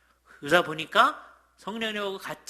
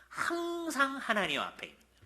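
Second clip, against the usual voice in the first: 항상 lifted much higher, then falling; stress on 항상; 10 semitones higher than usual. Real, not acted. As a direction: hit 항상 high and let the rest fall. Emphatic sermon point. (항상을 높게 강조하며)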